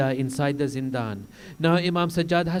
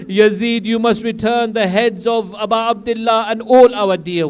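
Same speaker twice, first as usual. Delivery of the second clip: louder, rising, firm demanding voice; Zainab's demands; 4 semitones higher, 8 dB louder than usual. Acted. Zainab setting conditions before Yazid, loud and defiant. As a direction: demand it, each name a blow. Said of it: "She says".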